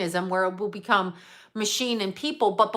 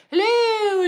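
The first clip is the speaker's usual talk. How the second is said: much higher, louder warbling Hello; Julia Child voice; 12 semitones higher, 4 dB louder than usual. Retold or imitated. Mocking Julia Child's greeting to a live audience, high imitation. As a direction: do the famous TV chef's high greeting. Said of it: "and talking like this".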